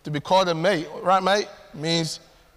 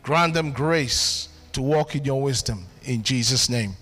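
closing prayer; 5 semitones lower than usual. Acted.

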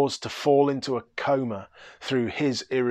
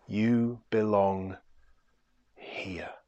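much softer and lower, slow; hushed reassurance; 3 semitones lower, 7 dB quieter, slower than usual. Acted. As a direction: let the words settle like a blessing. Bridegroom's final reassurance.

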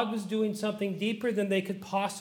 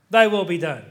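lower, loud and weighted surrender after struggle; hard-won submission; 4 semitones lower, 7 dB louder than usual. Acted. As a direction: say it as a costly final surrender. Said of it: "he pleaded, he sweated blood, and then said".

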